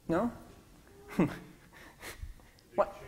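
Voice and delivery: ordinary talk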